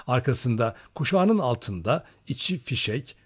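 usual speaking voice

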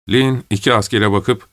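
ordinary delivery, nothing marked

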